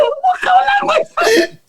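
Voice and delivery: very high-pitched laughing cry; incredulous, cracking-up outburst; laughing disbelief. Retold or imitated. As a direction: squeal it out slowly through laughter. Laughing disbelief at film accent.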